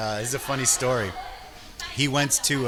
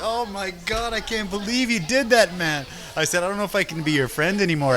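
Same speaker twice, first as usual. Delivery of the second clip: hammed-up shock at his friend; mock outrage; 6 semitones higher than usual. Teasing his friend about the noodle suitcase, mock outrage.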